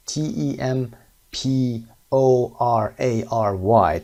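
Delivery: T E M P O R A R Y said letter by letter, slow; letter-by-letter spelling; spelled out letter by letter. Shown as a demonstration. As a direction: spell temporary slowly, one letter at a time. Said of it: "spelling out".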